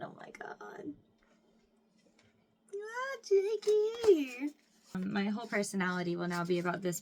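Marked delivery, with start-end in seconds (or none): 0.0-0.9 much softer whispered awe; awed whisper. Real, not acted. Fan gazing at cowboy cards, whispering in awe. 2.7-4.5 much higher, slow swoon; swooning coo. Real, not acted. Fan swooning over Jake's card, cooing. Fangirl sigh over Jake.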